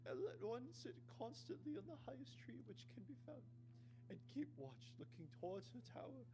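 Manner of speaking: a worried mother sending her sons away, high; mother blessing and warning her sons, very high at first; mother in falsetto. Acted. Reads the mother's farewell.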